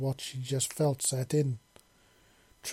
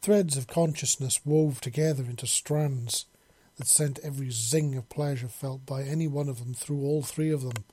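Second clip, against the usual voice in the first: usual speaking voice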